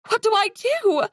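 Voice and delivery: high panicked cry; frantic, helpless plea. Acted. Talon panicking beside wounded.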